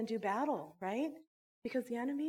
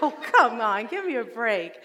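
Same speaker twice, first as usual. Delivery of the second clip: laughing exasperation; 11 dB louder, slower than usual. Retold or imitated. Kids refuse the pledge, exasperated.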